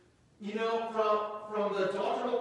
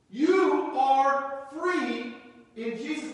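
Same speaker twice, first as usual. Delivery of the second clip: louder, slow and emphatic; loud slow proclamation; 4 semitones higher, 6 dB louder, much slower than usual. Real, not acted. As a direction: declare it to the church. Proclaiming freedom.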